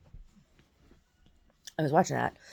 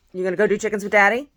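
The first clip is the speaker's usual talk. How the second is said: quick, loud, higher-pitched dog talk; talking to pet; 6 semitones higher, 10 dB louder, faster than usual. Real, not acted. Turns to ask her dog.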